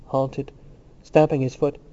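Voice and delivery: plain reading voice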